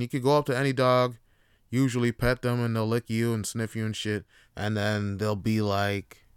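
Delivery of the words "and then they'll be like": ordinary delivery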